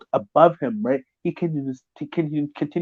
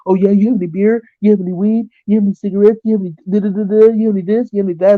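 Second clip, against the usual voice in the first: high, eager begging questions; mock him perking up for freebies; eager mooching questions; 7 semitones higher, 5 dB louder than usual. Imitating the neighbor eagerly asking for beer and weed. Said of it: "he'll be like".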